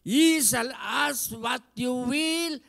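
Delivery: loud, high, slow proclamation; preacher declaring the promise; preaching. Acted. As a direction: declare it slowly. Repeated again.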